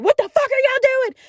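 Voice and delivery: high-pitched imitation of a yelling sister. Sister storming into the room at her siblings, yelling. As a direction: burst in scolding, voice shooting up. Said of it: "don't fucking yell at me".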